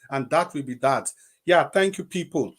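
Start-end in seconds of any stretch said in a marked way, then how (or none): none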